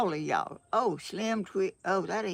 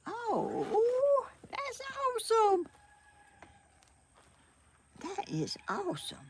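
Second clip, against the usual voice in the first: much higher, thrilled cry of delight; 14 semitones higher than usual. Real, not acted. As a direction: squeal it out in pure delight. Delighted reaction on screen.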